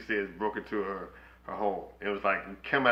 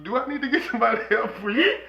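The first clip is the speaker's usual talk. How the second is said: mock concern; 13 semitones higher than usual. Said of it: "I'd be like".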